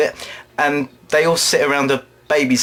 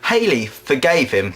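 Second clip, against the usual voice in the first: ordinary talk, nothing marked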